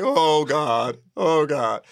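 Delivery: loud, high retold groan of alarm; mock dread. Director hearing the star's message, mock dread.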